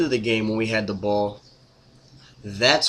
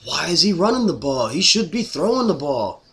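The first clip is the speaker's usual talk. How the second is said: whiny griping fan voice, higher and louder; mocking fickle fans; 8 semitones higher, 6 dB louder than usual. Fan mocks fans who now gripe about running, higher whiny mock voice. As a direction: mimic fans flipping their complaint. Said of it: "it's like".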